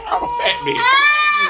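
high, drawn-out playful shout; comic outburst. Real, not acted. Teller describing snack and shows, high comic shout. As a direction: shoot way up and stretch it out.

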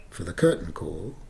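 ordinary talk, nothing marked

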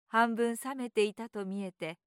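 ordinary delivery, nothing marked

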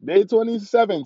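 hyped anime exclamation; excited shout. Pretend. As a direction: yell it out, pumped. Repeat, anime style.

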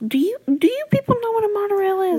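high, fast and disbelieving; sudden high incredulous burst. Real, not acted. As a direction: ask the audience in mock outrage. Incredulous question to audience.

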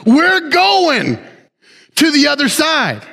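"We're going" lifted high and punched; preacher's triumphant declaration; emphatic proclamation. Real, not acted. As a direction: proclaim it with confidence.

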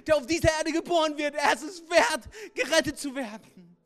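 impassioned preaching. Acted. Preacher voicing Jesus' vow to save people, loud. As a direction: proclaim it like a vow.

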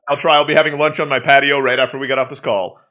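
ordinary talk, nothing marked

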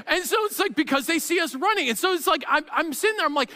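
ordinary talk

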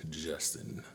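slow, soft, breathy DJ whisper; sultry voice. Acted. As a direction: whisper it like a smooth-jazz host. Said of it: "This is this sultry voice".

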